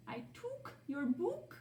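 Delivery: took and book with a long ooh, high and slow; high, slow ooh version. Shown as a demonstration. Teacher testing the wrong vowel, high and slow. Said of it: "is it t-ook".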